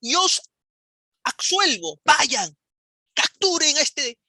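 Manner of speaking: shouting like the friar Valverde calling his soldiers to attack; priest's battle cry. Retold or imitated. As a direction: roar the order to seize the idolater. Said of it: "Valverde empieza a gritar y dice".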